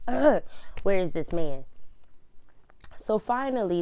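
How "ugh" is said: loud, higher-pitched groan of frustration; short, loud grunt of exasperation; exasperated ugh. Retold or imitated. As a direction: blurt out a fed-up "ugh". Retold exasperation while waiting.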